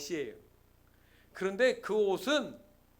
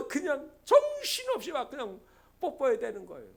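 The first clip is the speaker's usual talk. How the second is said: fervent, breathlessly piled-up sermon narration; impassioned preaching; 5 semitones higher, 7 dB louder than usual. Real, not acted. Preacher describing father kissing his filthy son, fervent delivery. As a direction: act out the frantic kisses as you preach. Preaches the embrace at full force.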